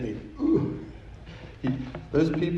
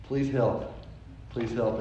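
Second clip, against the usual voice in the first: retold quiet prayer; 7 dB quieter, slower than usual. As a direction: pray under pressure, softly, with long pauses. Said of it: "I pressed the pause button, and I prayed".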